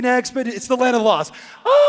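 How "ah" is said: panicked yell. Retold or imitated. Mock panic on the raft.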